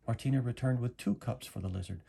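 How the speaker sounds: usual speaking voice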